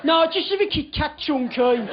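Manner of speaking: imitated Birmingham flatmate; Birmingham accent. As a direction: give in with broad Birmingham vowels. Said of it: "in his Birmingham accent".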